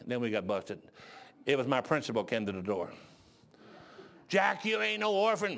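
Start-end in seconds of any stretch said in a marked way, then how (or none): 4.3-5.6 high, slow imitated reprimand; busting the culprit. Principal at the door exposes the fake orphan, high slow rebuke. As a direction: call him out with drawn-out words.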